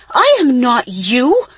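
high, loud, drawn-out words; angry yell. A narrator's reading. Isa cutting off her twin's excuse, yelling. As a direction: yell it slowly, every word hammered. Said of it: "Isa yelled".

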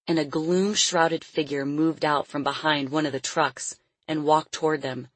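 plain reading voice

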